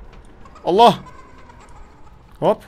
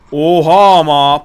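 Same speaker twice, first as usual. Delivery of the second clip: louder, drawn-out cry of shock; loud startled oha; 6 dB louder, much slower than usual. Real, not acted. Gamer seeing the clown speed up, loud slow oha. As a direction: let it out slowly and loudly, caught off guard.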